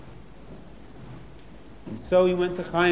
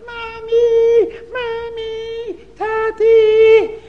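put-on high, loud panicked child voices; frightened kids calling; 8 dB louder, much slower than usual. Scared children running home calling their parents, panicked. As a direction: cry out for mommy in fright. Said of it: "the kiddies ran home fast".

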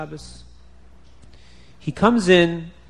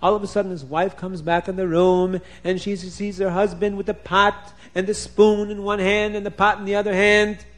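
ordinary delivery

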